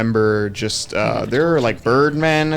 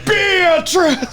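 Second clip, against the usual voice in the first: yelling a name. Retold or imitated. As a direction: bellow the name across hell. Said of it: "He's just yelling".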